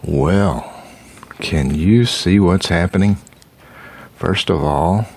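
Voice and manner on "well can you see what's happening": usual speaking voice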